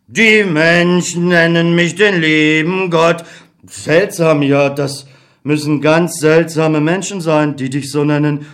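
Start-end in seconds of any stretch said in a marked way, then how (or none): 0.1-3.2 slow, lightly voiced old God; a hint of a tired old deity; God as weary old man. God introduces himself to Beckmann, slow and weary.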